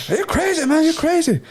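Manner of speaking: high, loud imitation of her denial; mock indignant woman. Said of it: "She's like".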